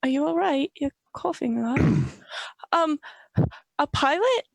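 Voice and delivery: ordinary delivery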